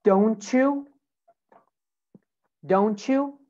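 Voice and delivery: "Don't you" run together as "don-choo"; "Don't you" with a linking CH; blended CH sound. Shown as a demonstration. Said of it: "a che sound".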